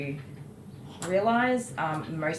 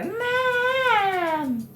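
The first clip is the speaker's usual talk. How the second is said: drawn-out, much higher imitation of a whining child; kids calling mum; 4 dB louder than usual. Mum imitating her kids bursting into her bedroom, high drawn-out call. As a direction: mimic a kid's long, high-pitched yell. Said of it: "my kids will come in there".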